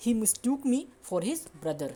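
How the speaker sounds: very slow careful English examples; slow model sentences. Shown as a demonstration. Teacher modelling the mistook variant slowly. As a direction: lay each word out slowly. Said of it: "इस तरह से बोल सकते हो".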